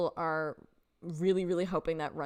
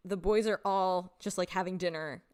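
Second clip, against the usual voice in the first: normal talking voice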